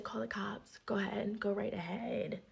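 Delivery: unvoiced whisper, daring her; whispered dare. Daring her roommate to call the cops, whispered. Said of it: "I said".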